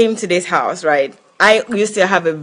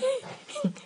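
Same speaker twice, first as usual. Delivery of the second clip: quick high laugh; 17 dB quieter than usual. Real, not acted. Nervous laugh after denial.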